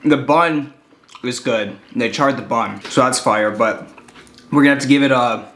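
chewing between words; talking around food; mouth partly full. Real, not acted. Seen 2: Holds bitten burger; lips pursed, chewing-like motion between words after bite.

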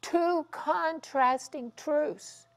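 ordinary talk

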